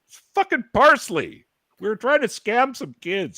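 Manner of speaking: high-pitched, loud acted confession; retold exasperated outburst. Teen confessing the parsley scam to dad, retold outburst. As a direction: blurt it out high, as if defending yourself.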